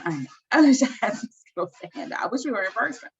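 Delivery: ordinary talk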